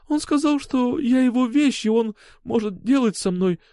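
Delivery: high, halting woman's voice; frightened. A narrator's reading. Алёна reports the threats to Сергей, frightened.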